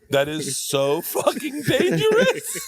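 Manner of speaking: laughing through a high, drawn-out, slow protest; cracking up while calling it dangerous; laughing disbelief. Real, not acted. Friend hears about fake doctor texts, laughing high and slow.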